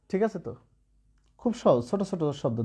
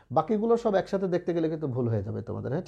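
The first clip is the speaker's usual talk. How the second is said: ordinary talk, nothing marked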